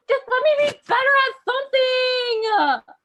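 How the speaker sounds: high, wailing tantrum shout; Wisconsin. Acted. Younger sister Wisconsin losing it at Minnesota, high shouted wail. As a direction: scream it like a whining little sister. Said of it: "And Wisconsin said".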